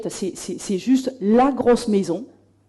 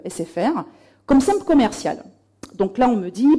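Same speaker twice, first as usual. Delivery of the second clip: normal talking voice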